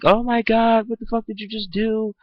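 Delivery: high shrieking mock panic of a mother; freaking-out mom. Mom panics over holes in the door, high mock shriek. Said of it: "my mom is freaking out".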